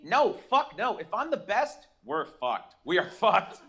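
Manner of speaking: ordinary talk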